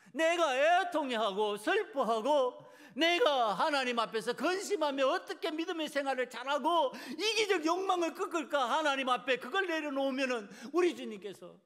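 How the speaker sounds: loud, high, building preacher's cry; impassioned preaching. Real, not acted. Preacher urging listeners to lay desire before God, impassioned. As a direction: pile up the phrases with mounting force.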